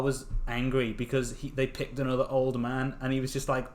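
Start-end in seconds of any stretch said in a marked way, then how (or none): none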